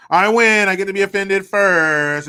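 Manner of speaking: loud, high, triumphant gloat; gloating shout. Pretend. Mocking offended people, loud gloating victory. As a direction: crow it like you won.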